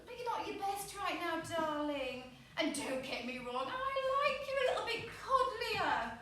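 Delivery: high, sugary condescension; patronising boyfriend. Acted. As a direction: coax as if being kind while putting them down. Acts boyfriend's patronising reply.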